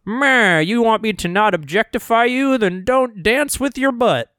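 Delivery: high, loud mocking voice; silly complainer voice. Podcaster mocking people who hate She-Hulk dancing, silly high complainer voice. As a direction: mimic a whiny objector in a silly voice. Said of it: "This is a silly voice".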